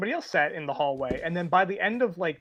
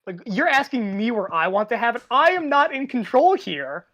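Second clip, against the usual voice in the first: loud, high incredulous objection; raised-voice mock exasperation, slowing for emphasis; retold exasperated protest; 6 semitones higher, 6 dB louder than usual.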